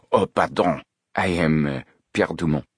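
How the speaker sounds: put-on courteous French-accented introduction; polite apology in a French accent. A narrator's reading. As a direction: introduce yourself as a French manager. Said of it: "spoke with a French accent".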